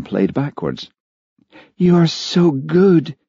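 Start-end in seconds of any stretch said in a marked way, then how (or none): 1.8-3.2 high, drawn-out, gushing; breathed admiration. A narrator's reading. Olivia to Charlie as her tears dry, breathed admiration. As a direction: gush it slowly in a raised voice. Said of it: "she breathed".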